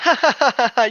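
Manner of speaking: real hearty laugh; loud high laugh. Real, not acted. Reading laughed at comment.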